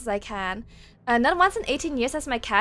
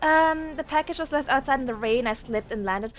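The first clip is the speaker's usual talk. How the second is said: ordinary delivery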